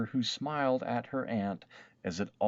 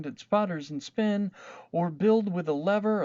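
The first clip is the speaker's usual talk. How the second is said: put-on loud, high, anguished child wail; a horrified little girl crying out never again; dismayed vow; 9 semitones higher, 5 dB louder than usual. A narrator's reading. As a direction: swear off building forever in despair. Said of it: "who thought".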